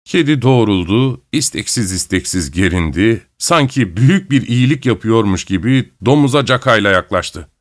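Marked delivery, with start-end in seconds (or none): none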